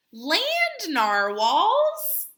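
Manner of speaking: loud and high with wonder; thrilled storybook reveal; excited reveal. A narrator's reading. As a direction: announce it like an amazing discovery.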